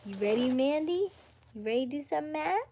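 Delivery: fast excited you ready call; hyped up. Real, not acted. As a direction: pump up the chat for math. Hyping chat for math.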